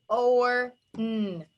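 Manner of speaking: horn split into separate "or" and hummed "n"; segmented phonics demo of "or n"; sounded out. Shown as a demonstration. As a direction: sound out OR, then a held N.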